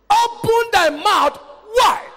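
loud, high, drawn-out proclamation; ringing command from the pulpit; proclaimed scripture. Real, not acted. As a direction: preach it out slowly, every word hit hard.

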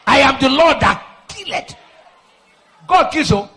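high shouted cries in broken bursts; fierce warfare prayer. Real, not acted. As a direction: cry out for divine vengeance, drawing out the key words.

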